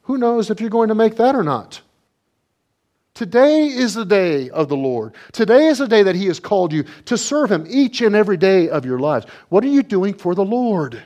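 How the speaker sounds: high, loud pulpit exhortation; fiery sermon call; full-force preaching. Acted. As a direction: preach it out to the whole congregation.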